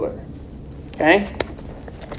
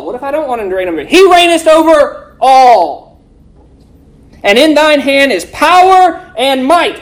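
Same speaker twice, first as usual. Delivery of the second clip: preaching scripture; 6 semitones higher, 4 dB louder than usual. Acted. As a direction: proclaim the verse to the congregation. Proclaims the verse like a preacher.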